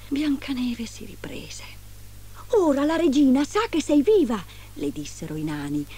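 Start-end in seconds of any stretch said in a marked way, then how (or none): 2.5-4.4 high, insistent dwarf voice; warning dwarfs. A narrator's reading. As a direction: warn in a lifted voice.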